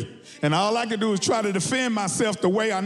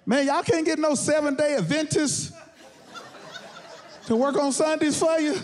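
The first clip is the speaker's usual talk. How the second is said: high, exasperated plea; pleading complaint; 8 semitones higher than usual. Acted. Customer arguing with a fast-food chain, high pleading complaint. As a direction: push the question high, as a protest. Acted complaint about Sunday closing.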